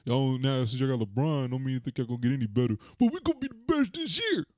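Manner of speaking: high, over-the-top fan bragging; hyped Lakers fan. Retold or imitated. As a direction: ham up a boastful superfan.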